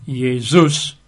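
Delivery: Jesus said yay-ZOOS; Jesus in Greek, Y at the front, OOS at the end; Greek pronunciation. Shown as a demonstration. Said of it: "how they would pronounce it".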